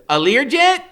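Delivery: put-on high, loud wisecracking boy; Freak's high smart-aleck voice. A narrator's reading. Boy joking about his own birthday, high and cocky. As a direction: crack the joke like a cocky kid. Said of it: "Freak says".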